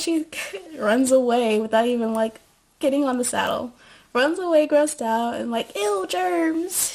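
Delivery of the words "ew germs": higher, prissy ew; prissy disgust. Retold or imitated. Rosie recoiling from the horse, prissy and disgusted. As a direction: pull away grossed out.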